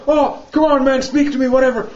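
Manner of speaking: high-pitched imitation of pleading; mock begging. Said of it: "And he starts begging".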